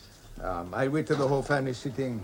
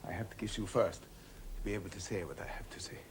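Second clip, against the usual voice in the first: softer, whispered confession; solemn whisper; 5 semitones lower, 9 dB quieter than usual. Acted. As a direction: hush before a grave admission. Confession to old friend.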